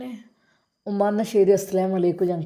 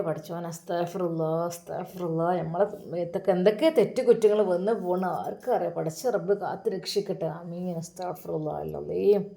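murmured repentance prayer; 4 semitones lower than usual. Acted.

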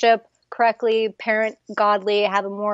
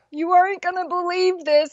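husband's news; 9 semitones higher than usual. Retold or imitated. Husband calling, crying with news.

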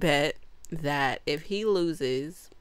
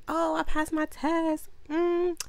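mock cheery; 11 semitones higher, slower than usual. Retelling her happy line.

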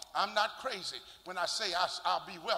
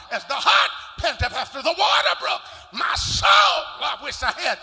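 loud, rising preacher's whoop; full-force preaching; 5 semitones higher, 11 dB louder than usual. Real, not acted. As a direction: preach it out to the congregation, building.

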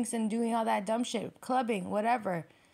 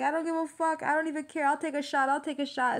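higher, mock-defiant comeback; stubborn; 6 semitones higher than usual. Virgo brushing off the reader's warning, mock stubborn. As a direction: playfully imitate a pigheaded fighter. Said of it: "you're so stubborn".

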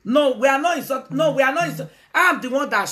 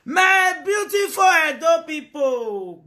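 slow, high, loud calling out; 6 semitones higher, 4 dB louder, slower than usual. Real, not acted. Hailing her Edo people on live video, slow loud call. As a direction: hail the crowd, slow and loud.

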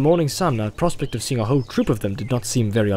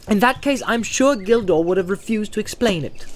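high, irritated retort; sharp; 9 semitones higher than usual. A narrator's reading. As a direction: cut him off testily, pitched up. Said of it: "said Frodo sharply".

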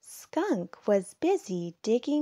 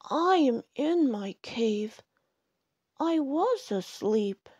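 louder, mildly grumpy bear; grumbling; 5 semitones higher, 4 dB louder than usual. A narrator's reading. Bear complains to Ladybug, grumbling. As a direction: grumble like someone just woken. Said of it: "grumbled Bear".

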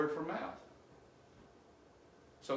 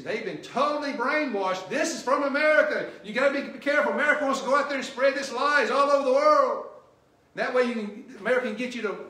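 loud, higher, full-force sermon shout; fiery preaching; 5 semitones higher, 9 dB louder than usual. Real, not acted. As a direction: blast the brainwashed message at the crowd. Preacher mocking brainwashing claims.

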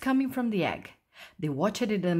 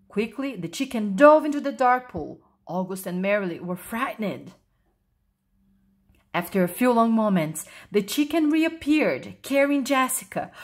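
usual speaking voice

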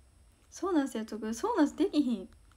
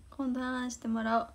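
ordinary talk, nothing marked

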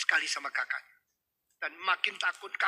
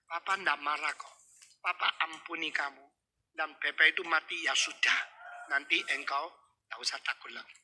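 forgiving father; 2 semitones lower, 4 dB quieter than usual. Acted. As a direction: comfort a frightened child tenderly. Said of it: "Dipeluk, sudah dipeluk, dilus-lus kepalanya".

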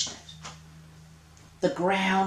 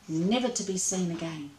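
ordinary delivery

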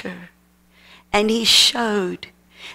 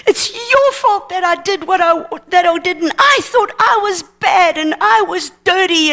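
high-pitched retold outburst; worked-up daughter accusing her parents; angry accusation; 7 semitones higher than usual. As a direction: throw the blame, pitched well up. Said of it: "and she was angry".

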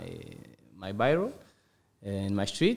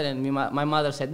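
ordinary talk, nothing marked

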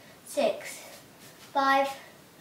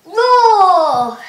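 loud lion roar; 10 dB louder than usual. Shown as a demonstration. Teacher counting the push-ups with a loud lion roar. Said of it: "and then you go roar".

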